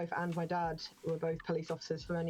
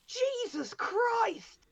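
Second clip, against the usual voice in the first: "Jesus Christ!" drawn out slow, much higher and louder; shocked exclamation; 5 dB louder, much slower than usual. Retold or imitated. Brother opening a drawer, shocked, slow and high. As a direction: recoil at what's in the drawer. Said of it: "just went".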